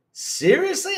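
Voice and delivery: very high, disbelieving question; incredulous. Real, not acted. Streamer reacting to Xbox fans, high and incredulous. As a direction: squeak it in disbelief.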